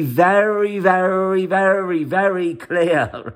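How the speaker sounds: each "very" drawn out, high and insistent; stress on VERY. Real, not acted. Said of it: "emphasize the word very".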